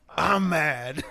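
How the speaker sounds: laughing through a mock-mad "I'm mad"; giggling fake fury; laughing mock anger. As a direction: play mad but can't stop laughing. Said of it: "I was like".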